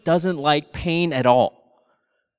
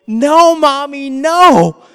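put-on higher, louder child's plea; imitating little son pleading; 8 semitones higher, 5 dB louder, much slower than usual. Little brother begging mom to stop, higher pleading child voice. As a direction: beg like a small boy protecting his brother. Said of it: "I hear Nathaniel's voice going".

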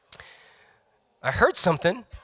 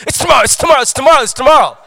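higher, louder, frantic chant; panicked cramming; 10 semitones higher, 12 dB louder, much faster than usual. Pretend. Crammer panicking at themselves the night before, frantic. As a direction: slap yourself awake in a panic. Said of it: "slapping your face going".

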